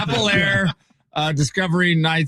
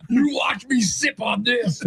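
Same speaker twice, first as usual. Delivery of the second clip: wrestling promo shout; 6 semitones higher than usual. Pretend. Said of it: "cut a wrestling promo".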